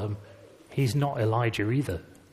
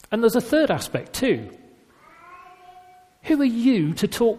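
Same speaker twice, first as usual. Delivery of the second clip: challenging question; 7 semitones higher than usual. Retold or imitated. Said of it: "there's a second part to that".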